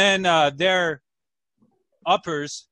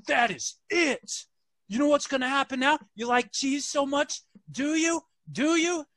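mock scolding; 10 semitones higher than usual. Officer scolds in story.

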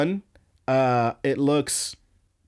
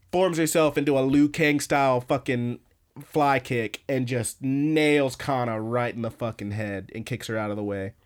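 ordinary talk, nothing marked

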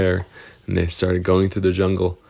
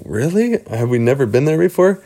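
put-on surprised kids; 7 semitones higher, 3 dB louder, faster than usual. Kids surprised about unknown place.

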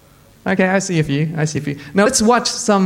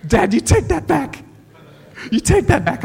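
mock-hurt protest, much higher; protesting son; 8 semitones higher than usual. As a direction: squeal it out like a wounded kid. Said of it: "And I'm like".

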